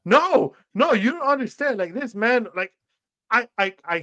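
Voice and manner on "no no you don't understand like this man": much higher, louder retold objection; alarmed protest. Telling coworkers they don't understand, alarmed protest. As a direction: protest urgently, pitch jumping up.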